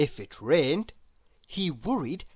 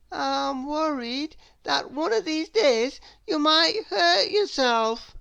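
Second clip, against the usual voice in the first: whiny worried voice rising higher; fretful Mister Worry; 10 semitones higher than usual. Acted.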